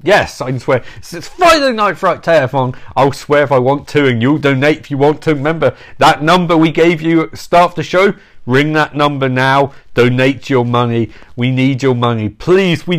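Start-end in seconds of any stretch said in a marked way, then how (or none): none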